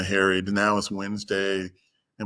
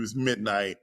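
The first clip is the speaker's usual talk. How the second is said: usual speaking voice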